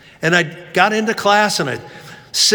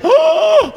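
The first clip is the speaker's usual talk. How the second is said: high, loud rehearsed gasp; fake shocked gasp; 5 dB louder than usual. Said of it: "looks of shock".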